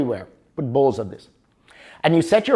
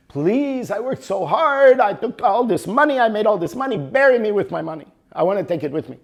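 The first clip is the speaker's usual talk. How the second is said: pleading husband; 6 semitones higher than usual. Acted. Dying husband begs his wife.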